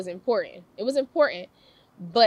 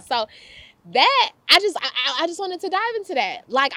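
normal talking voice

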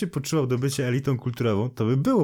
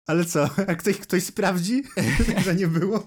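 high, laughing protest; amused, laughter breaking into the words; 7 semitones higher than usual. Real, not acted. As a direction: say it through giggles, pitched up. Joking about fake interview, both laughing.

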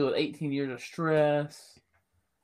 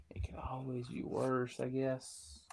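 muttered aside; 11 dB quieter, slower than usual. Real, not acted. Dry aside after grim joke.

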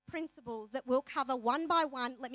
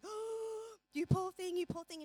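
high, cooing pity; mock sympathy; 5 semitones higher than usual. Girlfriends consoling the mum, high cooing sympathy. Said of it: "we're all listening going".

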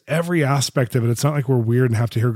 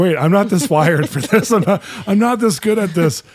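laughing protest; 8 semitones higher, 4 dB louder than usual. Retold or imitated. To her leader, laughing in mock panic. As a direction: laugh through an alarmed protest. Panicked reply to being handed missionary.

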